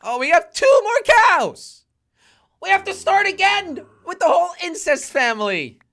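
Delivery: real half-laughing exasperated yell. Real, not acted. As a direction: cry out at the absurd loss. Discovers only two cows left.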